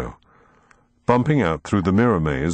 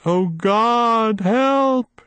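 high, desperate wail for help; a trapped old woman crying out to God; much slower than usual. A narrator's reading. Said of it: "she wailed".

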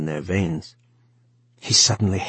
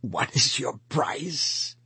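slow, soft, broken whisper; 6 semitones higher, 6 dB quieter, slower than usual. A narrator's reading. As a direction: barely breathe the question, slowly. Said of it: "he whispered at last".

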